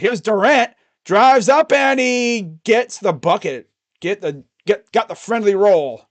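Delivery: lively live game commentary; play-by-play call. Acted. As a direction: call the drive like a broadcaster. Streamer calls the game live.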